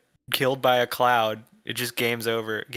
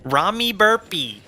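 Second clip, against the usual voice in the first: slow, higher, teasing name; mocking drawl; 6 semitones higher, 3 dB louder, slower than usual. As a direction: savor the silly name slowly.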